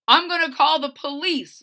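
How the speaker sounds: threatening shout. Acted. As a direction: bark the threat at her. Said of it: "Blake threatened".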